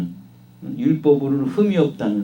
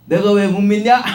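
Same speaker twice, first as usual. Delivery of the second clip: higher, louder indignant comeback; defiant retort; 7 semitones higher, 6 dB louder than usual. Retold or imitated. The Pharisee snapping back at Jesus, indignant and raised. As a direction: throw the question back at him. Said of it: "라고 하면은".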